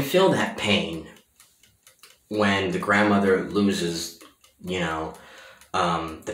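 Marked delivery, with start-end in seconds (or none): none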